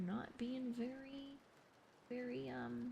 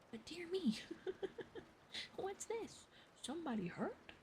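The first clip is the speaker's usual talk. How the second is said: higher, startled gentleman's voice; surprised naturalist; 7 semitones higher than usual. Acted. Stapleton coming upon the body, higher surprised voice. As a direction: play Stapleton stumbling on the scene, higher.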